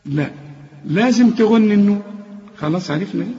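ordinary delivery

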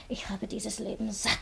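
slow weary whisper; a hopeless whispered confession. Acted. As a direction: breathe it out, worn out. Weary despair in quarrel.

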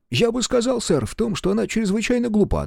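put-on high-pitched voice with an Irish accent; a lilting Irish soldier's report. A narrator's reading. Irish sergeant answers lieutenant. (ирландский акцент, высокий голос)

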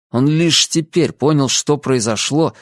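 ordinary delivery

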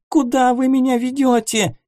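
high, frightened cry through tears; crying shout. Acted. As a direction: shout it high, on the edge of sobbing. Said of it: "кричала девушка сквозь слёзы".

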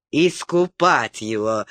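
ordinary delivery, nothing marked

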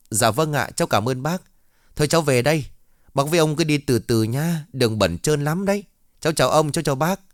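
a well-mannered student taking her leave; polite young girl. Acted.

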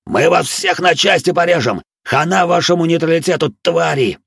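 full-throated angry shout; furious shout. A narrator's reading. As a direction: yell threats in rage. Bandit threatens captors.

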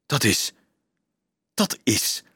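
halting, broken-off start; stammering. A narrator's reading. Dolf stares at the strange metal box, stammering. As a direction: stammer it, pausing between attempts. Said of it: "stamelde hij".

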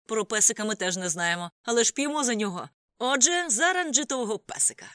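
building to high, loud proclamation; rousing toast. A narrator's reading. Boisterous toast building among friends.

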